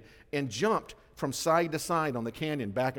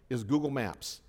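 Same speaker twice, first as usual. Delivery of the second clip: normal talking voice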